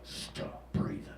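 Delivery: slow, hushed whisper; very soft, unvoiced command; sinister whisper. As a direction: whisper it like a voice inside the head. Brain's voice during sleep apnea.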